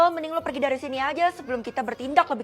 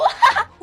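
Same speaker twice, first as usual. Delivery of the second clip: high, loud mocking cackle; mean cackle; 10 dB louder than usual. Bully cackles loudly at the humiliated new girl, mocking laugh. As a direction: cackle right in her face.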